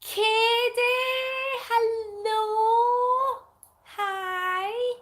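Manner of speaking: high, drawn-out hello; long high-pitched happy greeting; singsong greeting. Real, not acted. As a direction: greet a new arrival in a high, stretched call. Greeting a viewer who joins.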